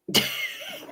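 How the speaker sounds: short high squeal of a laugh; high burst of laughter. Real, not acted. Reacting to the dinner gossip, high squealing laugh. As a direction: laugh high at your own impression. Laughs after own impression.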